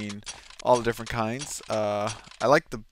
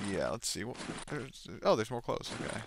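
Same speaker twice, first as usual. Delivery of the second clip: ordinary delivery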